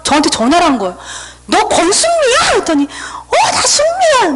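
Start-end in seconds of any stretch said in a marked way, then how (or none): 1.5-2.4 high, disbelieving question, re-enacted; astonished recognition. Checking if it's the childhood friend, astonished. 2.8-4.3 very high, tearful-sounding reunion cry, re-enacted; overcome with emotion. Friend confirms who she is, overcome with emotion. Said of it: "막 너무 혼자 막 감동하고 거기서 막 울었어요".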